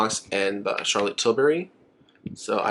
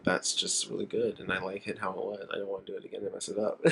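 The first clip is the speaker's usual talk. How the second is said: softer, murmured thought to herself; quiet inner voice; 3 semitones lower, 11 dB quieter than usual. Retold or imitated. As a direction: say it under your breath, as if to yourself. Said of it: "Because I'm like".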